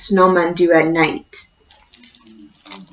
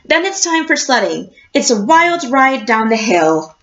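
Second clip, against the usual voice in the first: lively picture-book read-aloud with a slow closing question; reading to children. A narrator's reading. Teacher reading a picture book to her class, storybook voice.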